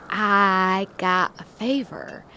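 slow hushed whisper. Acted. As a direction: whisper it like a secret. Secret request to Old Cobb.

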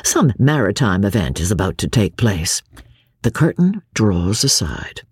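ordinary delivery

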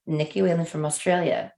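normal talking voice

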